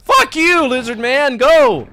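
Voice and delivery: loud high laughing shout; hyped yelling. Real, not acted. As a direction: roar at the screen, hyped.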